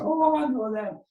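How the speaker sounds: high mock-casual brag in a put-on voice; high, smug mock boast; cringy name-dropping. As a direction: act out a cringy name-drop. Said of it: "it sounds really sort of cringy".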